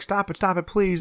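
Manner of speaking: high-pitched mock begging; retold pleading. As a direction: imitate a distressed plea to stop. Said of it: "Peter's like".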